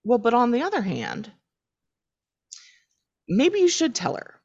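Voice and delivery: high, tentative advice in an acted voice; wavering second thoughts. Roommate reversing his advice to a friend, wavering second thoughts. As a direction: voice lifts as the argument flips. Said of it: "Sean is not sure what he thinks".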